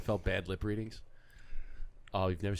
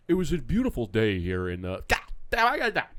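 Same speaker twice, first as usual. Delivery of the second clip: much higher, louder, cursing blooper voice; reporter swearing off-air; 9 semitones higher, 6 dB louder than usual. Retold or imitated. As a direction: switch from a newsy tone into a frustrated rant. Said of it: "his voice just changes".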